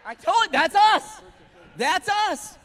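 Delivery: loud, high, laughing outcry; preacher shouting over the congregation's laughter; excited shout. Real, not acted. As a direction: burst out after the funny video. Reacting loudly to the sheep clip.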